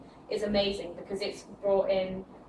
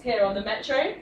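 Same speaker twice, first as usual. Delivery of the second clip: ordinary talk, nothing marked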